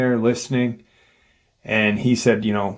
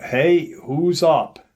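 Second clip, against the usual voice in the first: higher-pitched mock call-out; retold yell; 6 semitones higher, slower than usual. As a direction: imitate calling out, without full volume. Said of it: "He yells out".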